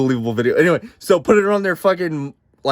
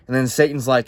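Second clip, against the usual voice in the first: usual speaking voice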